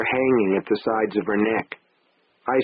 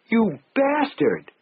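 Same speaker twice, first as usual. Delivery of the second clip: higher, slow anguished cry; cried out; 11 semitones higher, slower than usual. Acted. Wife cries out at husband after slap, slow high hurt cry. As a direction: stretch out the insult in hurt. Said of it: "she cried".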